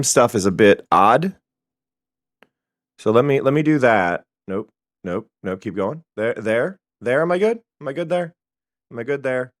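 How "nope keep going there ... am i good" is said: ordinary talk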